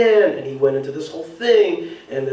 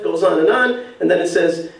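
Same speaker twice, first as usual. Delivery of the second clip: normal talking voice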